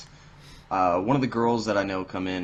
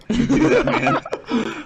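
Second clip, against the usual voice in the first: very high, loud laughing reply; laughing hard; 8 dB louder, much slower than usual. Real, not acted. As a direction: squeal it out while cracking up. Laughing reply in retold exchange.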